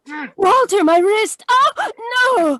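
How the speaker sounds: high, loud pained cries; cry of pain. Acted. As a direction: gasp and cry out as your wrist is twisted. He twists her wrist.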